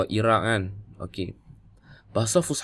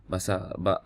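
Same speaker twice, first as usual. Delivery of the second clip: ordinary talk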